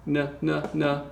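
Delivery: "N, n, n" as short separate sounds; teacher isolating "N, n, n"; consonant sound demo. Shown as a demonstration. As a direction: sound out "N, n, n" one by one. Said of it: "this is pronounced".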